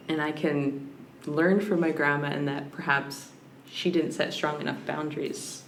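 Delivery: normal talking voice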